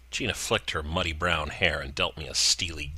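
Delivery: plain reading voice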